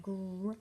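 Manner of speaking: "gr" said alone as a bare onset sound; isolated sound. Shown as a demonstration. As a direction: give just the sound "gr", not the letter names. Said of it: "The initial sound".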